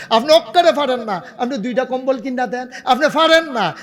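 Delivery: high-pitched preacher's challenge; pointed, high exhortation; insistent challenge. Real, not acted.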